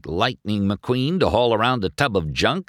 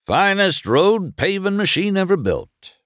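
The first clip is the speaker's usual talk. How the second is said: high-pitched, emphatic proclamation; proud announcement; 13 semitones higher, 4 dB louder than usual. A narrator's reading. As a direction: announce it like a showpiece. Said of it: "Doc announced".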